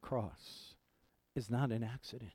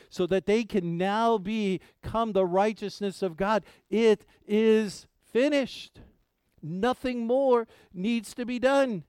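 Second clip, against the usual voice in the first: rising, louder preacher's cry; preaching at full force; 6 semitones higher, 6 dB louder than usual. Acted. Pastor stirring his congregation, impassioned preaching. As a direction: lift the congregation on each line.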